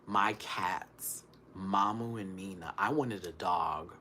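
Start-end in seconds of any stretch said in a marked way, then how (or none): none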